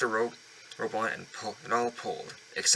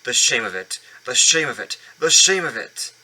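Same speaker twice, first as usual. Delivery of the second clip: building indignant cry; 3 semitones higher, 10 dB louder than usual. Acted. Proud engine shamed by dirty trucks, building indignant wail. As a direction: wail it out, growing each time. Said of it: "he grumbled".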